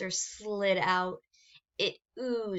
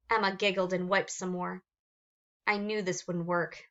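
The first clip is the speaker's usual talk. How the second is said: ordinary delivery, nothing marked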